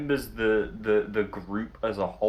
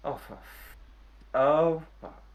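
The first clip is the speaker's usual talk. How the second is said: dawning horror; 4 semitones higher than usual. Acted. Hungover man realizes the mess.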